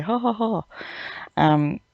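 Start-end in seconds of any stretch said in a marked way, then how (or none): 0.0-0.6 mock villain laugh. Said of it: "but that was like".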